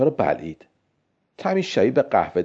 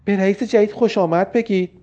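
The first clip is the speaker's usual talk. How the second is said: shouting; 8 semitones higher than usual. A narrator's reading. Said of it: "بونی فریاد کشید".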